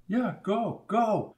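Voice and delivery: trembling, barely holding together; shaky voice. A narrator's reading. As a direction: let the voice quiver. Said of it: "said Barbara in a shaky voice".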